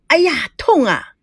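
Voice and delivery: a hammed-up ouch; 演出来的痛叫; yelp of pain. A narrator's reading. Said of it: "赵熙不禁大叫".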